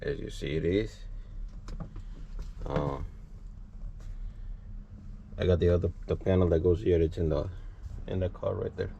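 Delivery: normal talking voice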